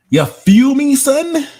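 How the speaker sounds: very high, loud hammed-up Brooklyn squeal; exaggerated Brooklyn accent. Streamer teasing chat about accent, exaggerated Brooklyn accent. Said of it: "I do have a B K accent".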